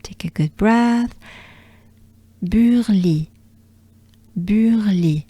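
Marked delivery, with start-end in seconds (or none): 2.4-5.3 Burly joined, with French u and throat r; French pronunciation. Shown as a demonstration. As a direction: model "Burly" as one French word. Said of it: "Do it with me".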